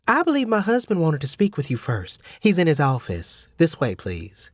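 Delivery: the gracious mother, courteous and formal; lady of the house, ushering him along; Mrs Hubbard. Acted.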